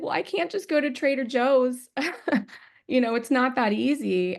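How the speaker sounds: high, amused, half laughing; laughing reply. Retold or imitated. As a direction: answer the child with a laugh in the voice. Her reply to daughter.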